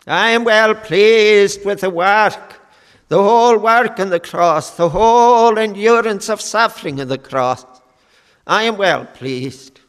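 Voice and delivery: ringing proclamation from the pulpit; forceful proclamation. Retold or imitated. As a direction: preach the Father's approval with full force. Preacher voices Father's approval, rising.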